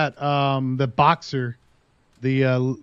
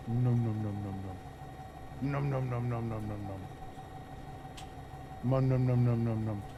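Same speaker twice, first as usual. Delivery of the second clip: playful nom noises; 5 semitones lower, 13 dB quieter than usual. Pretend. Voicing dog chewing foam.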